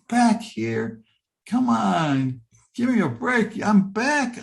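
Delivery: high, incredulous give-me-a-break whine; exasperated protest. Retold or imitated. Man back in his body protesting to the light, exasperated and high.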